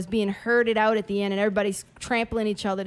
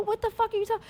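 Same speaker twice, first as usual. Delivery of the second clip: shocked; 11 semitones higher, 5 dB quieter, faster than usual. Retold or imitated. Shocked disbelief.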